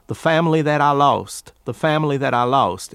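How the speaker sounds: Southern family, I as "ah", lost as "lowst"; Southern drawn vowels on family and lost; Appalachian Southern accent. Shown as a demonstration. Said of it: "the South, you have ah".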